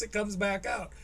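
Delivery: ordinary talk, nothing marked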